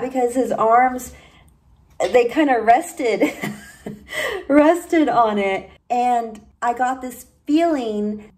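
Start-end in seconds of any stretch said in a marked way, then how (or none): 3.9-4.4 a quick amused laugh that breaks off; short laugh. Real, not acted. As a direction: let a brief laugh slip out mid-sentence. Laughs mid description.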